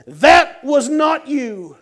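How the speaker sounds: slow, high shout of disbelief; loud, stretched-out insistence; amazed exclamation. Retold or imitated. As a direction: belt it out in amazement, drawn out. Friend's amazed exclamation acted out.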